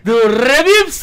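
high, loud, stretched shout; excited shout. Real, not acted. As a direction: yell the sponsor name out. Excited sponsor reveal.

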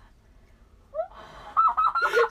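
high, loud burst of laughter; laughing fit. Real, not acted. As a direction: shriek with helpless laughter. Cold mask smeared on face. (lautes, hohes Auflachen)

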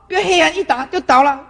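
ordinary talk

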